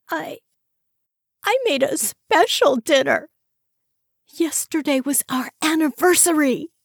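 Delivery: hurt. A narrator's reading. Wife telling husband he forgot the anniversary, hurt. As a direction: let the hurt show.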